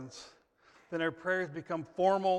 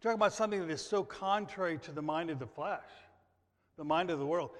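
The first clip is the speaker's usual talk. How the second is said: ordinary delivery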